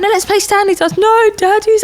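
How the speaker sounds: put-on high childish back-and-forth; toddler voice. Mum acting out a darts squabble with her son in a high childish voice. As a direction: squeak both sides like little kids arguing. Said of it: "And I'm like".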